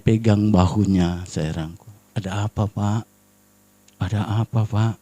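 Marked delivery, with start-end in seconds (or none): 2.2-4.9 low, very soft concerned question; gentle reassurance. Retold or imitated. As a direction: soothe an angry man quietly. Menenangkan bapak yang marah. (bertanya lembut dan menenangkan)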